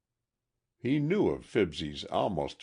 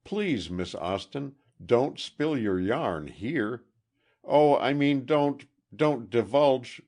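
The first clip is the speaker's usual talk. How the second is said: raised, stumbling urgent plea; excited; 7 semitones higher, 6 dB louder than usual. A narrator's reading. Excited boy begging Miss Austin not to tell here, stumbling urgent plea. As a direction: trip over the words in a rush. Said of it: "nearly choked the excited boy".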